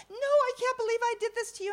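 fast, high-pitched imitation; mortified protest. Woman apologizing to her helper, mortified. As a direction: cut in with hammed-up embarrassment. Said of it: "she's mortified".

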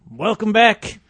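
big booming announcer voice; booming kids show opener. Pretend. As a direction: boom it out like a kids show host. Radio show opening announcement.

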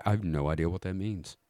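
ordinary talk, nothing marked

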